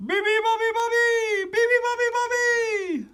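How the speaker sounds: high, loud animal chant; blaring high bird-call imitation; loud singsong. A narrator's reading. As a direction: sing it out as a noisy chorus. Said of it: "sang all the animals".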